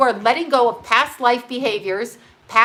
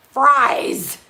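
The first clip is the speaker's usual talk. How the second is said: longing yelp; 3 semitones higher, 6 dB louder than usual. Real, not acted. Craving fries.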